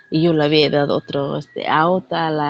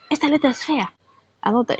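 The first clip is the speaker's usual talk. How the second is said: imitating a picky boss, pitched higher; mock scolding over ugly handwriting; strict boss voice; 8 semitones higher than usual. (jefa estricta regañando por la letra fea, imitada)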